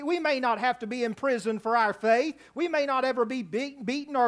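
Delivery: normal talking voice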